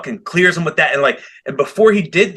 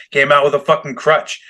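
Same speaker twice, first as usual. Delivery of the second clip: normal talking voice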